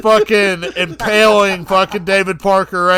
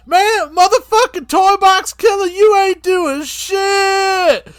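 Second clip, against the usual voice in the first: much higher, slow, mocking psycho voice; Sick Ripper voice; 9 semitones higher, slower than usual. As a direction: squeal it like a giddy maniac.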